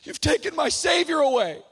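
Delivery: high, dramatized wail; weeping outcry. Retold or imitated. Mary answering the angels, acted tearful outcry. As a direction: imitate a grieving woman's anguished cry. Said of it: "she's weeping".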